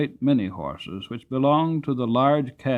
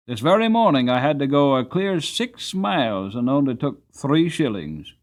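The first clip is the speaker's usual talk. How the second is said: louder, pleading cabman near despair; almost desperate; 4 semitones higher, 6 dB louder than usual. A narrator's reading. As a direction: plead with the governor as if you have nothing left. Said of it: "in a voice that sounded almost desperate".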